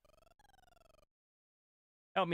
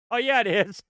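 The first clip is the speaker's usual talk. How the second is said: excited surprise; 9 semitones higher, 7 dB louder than usual. Real, not acted. Surprised to be right.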